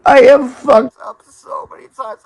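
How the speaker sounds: high, loud sob-laden confession; loud sobbing cry. Real, not acted. Drunk man crying to his audience, sobbing loudly. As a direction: wail the admission through tears.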